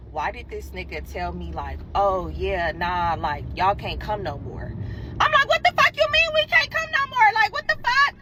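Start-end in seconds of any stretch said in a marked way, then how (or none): 1.9-4.7 much lower, offhand dude voice; casual guy voice. Retold or imitated. She voices the guy's reply. 5.5-8.1 much higher, loud outraged yell; high furious what-the-fuck. Retold or imitated. Outraged reply to being uninvited.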